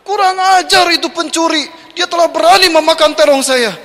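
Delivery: high, loud outraged shriek; shrieking wife. Retold or imitated. Wife shrieks about the eggplant thief, high and loud. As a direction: scream it like a wife robbed. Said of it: "Menjerit isu ke istrinya".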